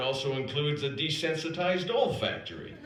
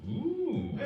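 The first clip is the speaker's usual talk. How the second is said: mock ooh; 7 semitones higher, 6 dB quieter than usual. Teasing reaction to Playboy reveal.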